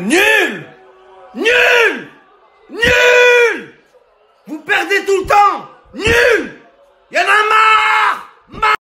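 high shouted jeers; furious fan rant. Acted. Fed-up fan berating the losing team, furious shouting. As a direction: yell each insult in exasperation.

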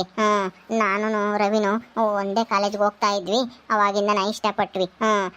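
meek daughter-in-law begging to be let in; young bride's voice. Acted. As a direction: plead humbly with your new mother-in-law. Bride pleads with mother-in-law.

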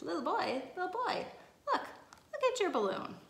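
high, loud excited call with a laugh; shouting. A narrator's reading. Woman in the crowd telling the boy to look, shouting. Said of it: "a woman shouts".

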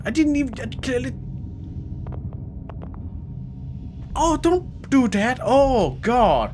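plead, rattled and high-pitched; frantic whine as it gets close; panicked high pleading. Real, not acted. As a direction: voice jumps high in alarm.

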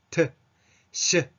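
"トゥ、シュ" with dropped jaw and unrounded lips; unrounded open vowel. Shown as a demonstration. Teacher showing the better reading, unrounded "トゥ、シュ". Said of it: "顎を下げて".

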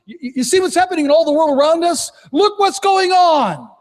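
high, fast alarmed bystander; a hint of an anxious crowd, pitched high; worried world. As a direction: sound like panicked people pointing at the news. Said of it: "When the rest of the world is saying".

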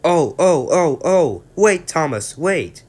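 high, loud squealing truck voices; calling trucks. Acted. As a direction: wail after the engine to wait. Trucks left behind calling Thomas.

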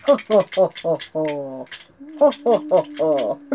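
lightly voiced crocodile chuckle; ho-ho like a sly crocodile; crocodile laughing. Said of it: "He's laughing".

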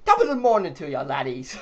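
a hammed-up Irish greeting; Irish accent. Shown as a demonstration. Gamer attempts an Irish accent on the spot, hammed-up Irish. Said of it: "I can't do my Irish accent now".

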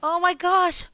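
high, loud horrified yelps; panicked apology shouted out; shocked outcry. Real, not acted. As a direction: cry out in dismay at the accident. Burned pig's house down.

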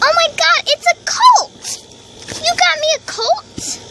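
high, excited squeal of surprise; thrilled. Acted. A girl getting a colt as a gift, thrilled and high. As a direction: gush over the surprise gift, pitch way up.

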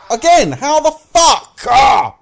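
high, loud, drawn-out disbelief; slow, screamed exasperation; exasperated scream. Real, not acted. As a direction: wail it in outraged frustration. Killed again in game.

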